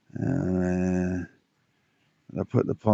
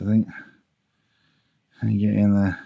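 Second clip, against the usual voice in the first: ordinary talk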